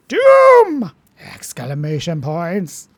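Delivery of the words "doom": huge high yell on Doom!; booming shout. Acted. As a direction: bellow Doom! at full volume.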